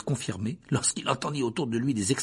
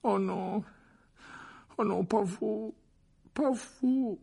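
high, plaintive groan of dismay; Harry moaning in dread as Lockhart leans over him; 11 semitones higher, slower than usual. A narrator's reading. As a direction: moan it out, begging him not to come. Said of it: "gémit-il".

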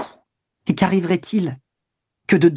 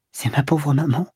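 tearful. Acted. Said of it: "d'une voix toute mouillée".

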